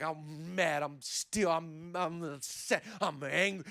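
low, soft mock seething; mad and frustrated. Imitating someone still bound and frustrated, low angry grumble. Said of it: "so angry, so mad, so just frustrated".

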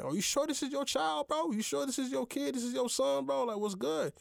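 high, disbelieving quizzing of a friend; incredulous questioning. Retold or imitated. Retelling his own suspicious questioning.

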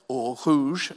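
French wine name said in an exaggerated French accent; mock French accent. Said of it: "my best French accent".

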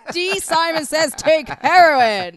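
loud, high, drawn-out shout; hammed-up slow yell; mock proclamation. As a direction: bellow it slowly as a joke order. Jokey proclamation in a put-on voice.

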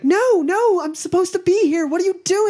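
very high, loud distressed squeal; panicked woman. Acted. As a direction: protest frantically in a high female voice. GM voices panicked woman resisting rescue.

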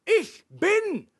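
"Ich bin" very high and loud, set apart; emphatic key words. Shown as a demonstration. Preacher drives home the key word to the congregation, emphatic. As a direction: proclaim "Ich bin" with full weight. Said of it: "dass das wichtige Wort ist".

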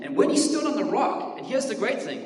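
rising, fervent pulpit voice; passionate preaching. Acted. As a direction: preach it with fire. Preaching at full force.

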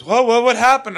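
high, loud imitation of a panicking mom; alarmed mother. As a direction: burst out in mock alarm. Retold mother alarmed on phone.

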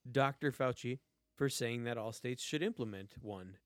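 Doctor punched at the start of the line; stress on Doctor. Shown as a demonstration. Insisting on the title Doctor while reading the story. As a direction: hit Doctor, then read the rest evenly. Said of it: "I wanna emphasize the word doctor".

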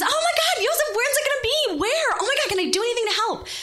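very high, gushing squeals of excitement; shrieky excitement, retold; excited screaming. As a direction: fire off thrilled questions way up high. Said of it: "I screamed, I yelled".

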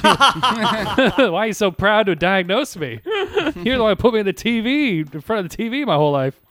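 giggling while complaining to mom; real laughter breaking into the line; laughing. Real, not acted. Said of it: "laughing while retelling".

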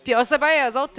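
ordinary talk, nothing marked

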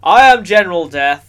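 booming villain promo voice; wrestling promo. Pretend. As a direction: announce yourself like a wrestling heel. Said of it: "You will hear me monologue".

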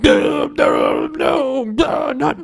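a squealing, panicked no; protesting troll. Retold or imitated. Troll being twirled in a slow dance, wailing protest. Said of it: "the troll's just being like".

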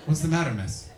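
ordinary delivery

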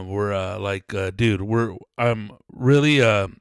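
ordinary talk, nothing marked

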